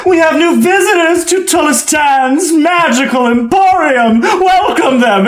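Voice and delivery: high, slow showman's welcome; grand host announcement. Acted. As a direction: proclaim it to the whole room with relish. Host announces newcomers to crowd.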